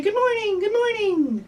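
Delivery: high, singsong bird greeting; birds greeting morning. Acted. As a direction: chirp it out as waking birds. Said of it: "birds are gonna say".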